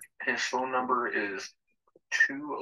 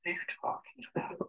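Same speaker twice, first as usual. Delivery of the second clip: whispering; 6 dB quieter, faster than usual. Real, not acted. Whispered reply.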